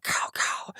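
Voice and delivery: whispered, breathy pop of distant gunfire; hushed gunshot noises; imitated gunshots. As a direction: mouth the shots softly like far-off pistols.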